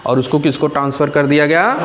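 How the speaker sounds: ordinary talk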